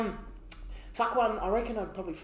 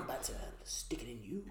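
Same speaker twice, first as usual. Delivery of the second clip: breathy menacing whisper; 8 semitones lower, 13 dB quieter than usual. Pretend. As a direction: whisper it like a threat. Whispered punchline.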